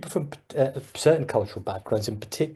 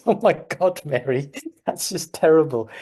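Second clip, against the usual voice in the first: higher, louder retold shock; mock dismay; 5 semitones higher, 4 dB louder than usual. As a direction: act out being appalled, half in fun. Acting out own shocked reply to Mary.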